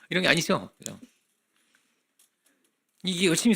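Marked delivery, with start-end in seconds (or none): none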